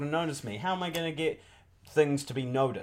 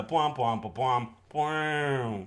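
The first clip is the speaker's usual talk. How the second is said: mouth-made brass blasts; imitated noise; 2 semitones lower, much slower than usual. Suggesting noises for the theme song, imitated. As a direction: sound out a drawn-out horn. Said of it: "also we need the".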